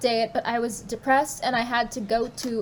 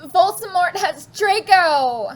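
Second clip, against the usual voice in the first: loud, slow, higher cry; shouting; 7 semitones higher, 5 dB louder than usual. Acted. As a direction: shout it in alarm. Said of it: "We shouted at the same time".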